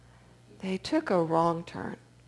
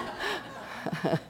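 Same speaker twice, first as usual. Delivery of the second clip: soft laugh; 11 semitones higher, 8 dB quieter than usual. Real, not acted. Speaker laughs, moved.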